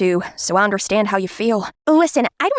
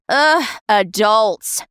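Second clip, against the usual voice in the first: put-on slow, drawn-out teenage eye-roll; exasperated groan; 3 semitones lower, much slower than usual. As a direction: sigh it out like fed-up teen. Teen exasperated about adults.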